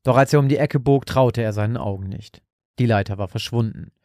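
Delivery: ordinary delivery, nothing marked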